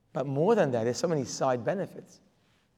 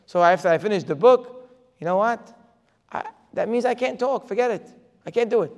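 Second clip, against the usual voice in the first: exasperated; 5 semitones higher, 6 dB louder than usual. Retold or imitated. Acting his own exasperated reaction.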